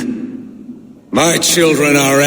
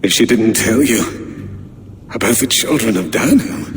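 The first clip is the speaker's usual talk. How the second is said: ordinary talk, nothing marked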